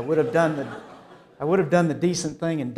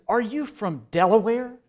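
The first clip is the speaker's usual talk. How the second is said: raised, slightly put-on voice of the puzzled deli man; imitated New York counterman; 6 semitones higher, 4 dB louder than usual. Deli counterman asking the Southern customer where he's from, in a higher, lightly put-on voice. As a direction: quiz the Southerner like a baffled New Yorker.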